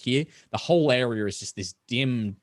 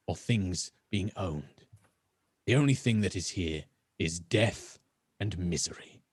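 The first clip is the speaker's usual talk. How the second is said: rasping elf; 4 semitones lower, 4 dB quieter than usual. Acted. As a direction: speak of death like a cold omen. Elf ominous speech.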